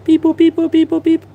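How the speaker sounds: high, loud robotic beep-boop; scanner beeps. Pretend. Painting the scanner, making its beeping noise out loud. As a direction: chirp it like a toy scanner. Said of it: "on the doohickey scanner".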